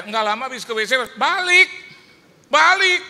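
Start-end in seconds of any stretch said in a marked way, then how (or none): 1.1-3.0 high, loud incredulous shout; indignant yell. Retold or imitated. Preacher exclaiming the host came back, loud yell. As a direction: yell it in mock disbelief.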